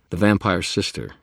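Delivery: usual speaking voice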